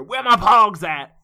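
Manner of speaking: loud, high, drawn-out holler; big slow rapper-style yell; game intro catchphrase. Acted. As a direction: shout it like a video game's start-screen sound effect. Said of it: "like, the intro sound effect".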